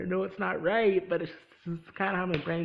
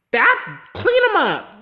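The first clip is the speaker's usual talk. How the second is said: loud, higher, slow order; loud slow command; 11 semitones higher, 10 dB louder, slower than usual. Real, not acted. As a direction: stretch out the command. Climax of the rant.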